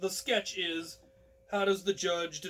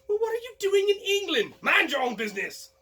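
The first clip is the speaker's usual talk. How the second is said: mock bailiff; 13 semitones higher, 6 dB louder than usual. Bailiff shouts at the Frenchman.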